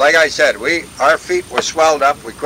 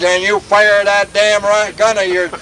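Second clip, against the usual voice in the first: raised-pitch retold firing order; angry officer; 6 semitones higher than usual. Officer orders gunner to fire, retold anger. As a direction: act out a cross officer's command. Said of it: "He was really mad".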